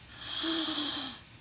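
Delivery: sharp intake of breath in awe; real gasp of wonder at the present; delighted gasp. Real, not acted. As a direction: gasp as the gift appears. Unwrapping a gift.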